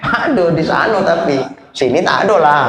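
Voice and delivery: ordinary talk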